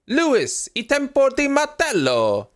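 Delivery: high mock Italian accent; fake Italian accent. Mocking radio message in fake Italian accent. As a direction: ham up a faux Italian accent. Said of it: "say the words in a faux, fake accent".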